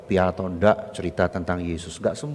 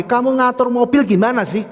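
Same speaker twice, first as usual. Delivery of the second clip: very high, loud acted telling-off; angry scolding; 9 dB louder than usual. Woman blaming the guard for her blocked car, angry scolding. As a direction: snap at the guard as an irate driver. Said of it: "Saya dimarah-marahin lagi".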